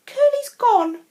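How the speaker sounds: high, loud alarmed cry; alarmed call. Acted. Farmer crying out to her children that the pig is gone, alarmed. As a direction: announce the lost pig with alarm.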